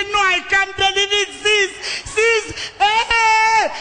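high loud crying-out disbelief; aghast disbelief. Retold or imitated. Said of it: "She said".

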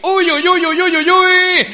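high rapid near-miss cries; excited uy uy. Real, not acted.

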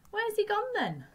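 high, sharp startled question; sudden surprise. Acted. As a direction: blurt it out in sudden alarm. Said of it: "Then Ma said suddenly".

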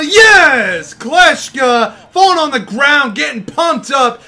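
loud, high hype yell; pumped up. Real, not acted. Gamer celebrating a comeback play, pumped up and shouting. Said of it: "just like me right now".

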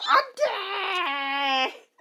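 long drawn-out wail of dismay; anguished cry. Real, not acted. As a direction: stretch it into a pained cry.

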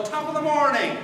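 high, loud Irish greeting call; shouted greeting. Shown as a demonstration. Speaker calling to the audience, loud cheerful greeting. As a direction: call it out to the room. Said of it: "I'll shout out".

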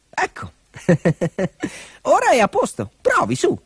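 high, chuckling little dog voice; grinning dog. A narrator's reading. Dog pretends machine is fixed, cheerful. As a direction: announce success high with a pleased chuckle. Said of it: "riapparve con un largo sorriso".

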